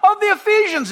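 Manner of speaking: loud, high chant of the pagan crowd; crowd shout. Retold or imitated. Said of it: "the people would shout".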